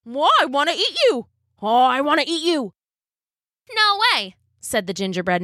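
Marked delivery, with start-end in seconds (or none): 0.0-2.7 high, eager shaggy dog; hungry, excited dog. Acted. Dog character speaking. 3.8-4.4 high cheeky cookie voice; gingerbread man voice. Acted.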